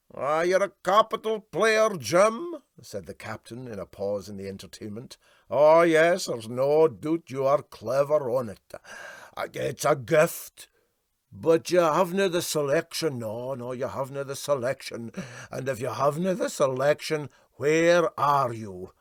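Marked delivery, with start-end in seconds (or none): 0.1-2.6 Scots captain. A narrator's reading. Captain praises the musician. 5.5-18.9 put-on loud, bluff old skipper in broad Scots; boastful Highland skipper, loud; Scots captain, holding forth. A narrator's reading. Captain voiced at length.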